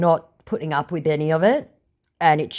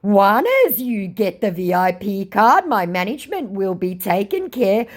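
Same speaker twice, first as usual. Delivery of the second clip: scammer voice; 9 semitones higher, 3 dB louder than usual. Retold or imitated.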